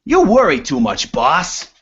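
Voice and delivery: loud, high character voice; dwarf henchman's voice. Acted. Second dwarf climbing up to his boss, henchman voice. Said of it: "The other one climbs up and says".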